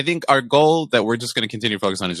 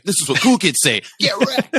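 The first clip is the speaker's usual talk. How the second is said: mock cool kid; 10 semitones higher than usual. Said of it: "I was like".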